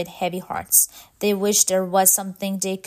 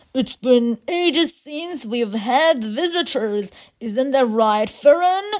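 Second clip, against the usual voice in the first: louder, higher welcoming robot queen; lilting robot voice, rising to the question; robot leader; 4 semitones higher, 5 dB louder than usual. Acted. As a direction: greet visitors as a grand robot leader. Robot leader greets visitors.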